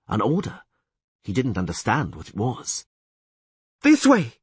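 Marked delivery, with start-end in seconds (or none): 3.8-4.4 sharp high shout. A narrator's reading. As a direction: yell it out urgently. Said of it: "shouted Yennefer".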